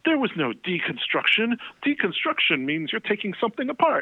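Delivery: a high, indignant rock-star rant. Retold or imitated. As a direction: correct the interviewer with haughty scorn. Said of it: "He really talks like that".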